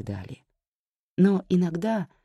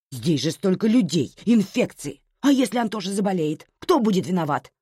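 higher, louder fretful wailing words; mother's agitated reproachful lament; anxious lamenting; 6 semitones higher, 5 dB louder than usual. A narrator's reading. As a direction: lament and fuss, piling up worries. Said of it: "начала причитать мать".

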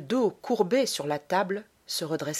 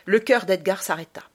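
usual speaking voice

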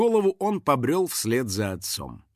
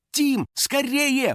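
high, stretched urgent call; urging; 12 semitones higher, much slower than usual. A narrator's reading. Trapped girl hurrying her friend, urging. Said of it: "поторопила друга Кристина".

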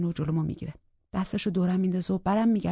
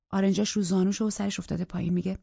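ordinary delivery, nothing marked

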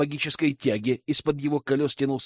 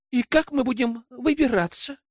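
much higher squeaky whine; squeaking; 10 semitones higher than usual. A narrator's reading. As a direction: squeak it out helplessly. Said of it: "запищала Аманда".

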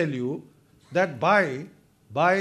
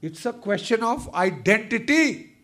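ordinary talk, nothing marked

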